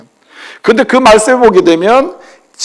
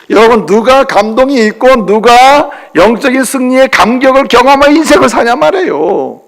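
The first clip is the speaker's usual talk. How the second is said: raised, higher preaching voice building to hallelujah; fervent preaching; 5 semitones higher than usual. Acted. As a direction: preach it out with full conviction. Preaching at full force.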